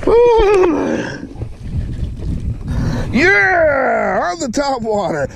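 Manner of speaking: high whoop and long cheer; real elated celebration; triumphant. Real, not acted. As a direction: voice soaring, crowing over the catch. Celebrating top water catch.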